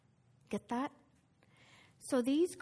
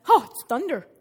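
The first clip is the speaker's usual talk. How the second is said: louder, brushing it off; loud dismissive crowd; 14 dB louder than usual. Acted. Preacher voicing the crowd's loud dismissive guess. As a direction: blurt it out like the obvious answer.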